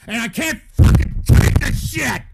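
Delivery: real rage, very loud; furious scream. Real, not acted. Streamer pissed off by chat, screaming in fury. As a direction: burst into a strained yell, at the end of your rope. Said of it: "You fucking people are pissing me the fuck off".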